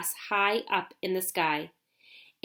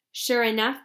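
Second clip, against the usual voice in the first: ordinary delivery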